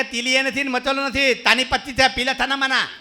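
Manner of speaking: high loud lisped lines; a lisping mother declaring loudly; mother lisps loudly at the family. Retold or imitated.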